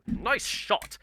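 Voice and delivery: high-pitched British cheer; British soldier. Acted. British guy heard from across the tank, British accent. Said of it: "the British guy kinda go".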